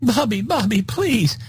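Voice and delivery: high pleading voice; pleading wife. Retold or imitated. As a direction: beg him high and shrill-free. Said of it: "high-pitched squeaky voice".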